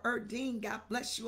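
normal talking voice

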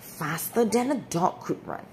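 ordinary delivery

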